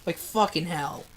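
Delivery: quiet, low tearful cursing; close to tears. Real, not acted. Reader who put the book down, quietly tearful. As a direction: mutter it low, choked up for real. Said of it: "I'm really starting to cry".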